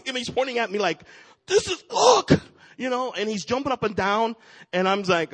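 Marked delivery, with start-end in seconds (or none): 1.5-2.3 high-pitched, loud mock squeal of amazement; excited little kid. Imitating a man pointing at his helper, overjoyed squeal. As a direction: imitate a kid overwhelmed by a Christmas gift. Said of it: "like a little kid on Christmas".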